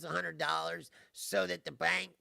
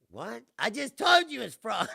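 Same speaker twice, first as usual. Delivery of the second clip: louder, higher, re-living the disbelief at the counter; retold indignant outburst; 5 semitones higher, 9 dB louder than usual. Telling off the bank guy, indignant and louder. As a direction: snap back like he's not listening. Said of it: "And I was like".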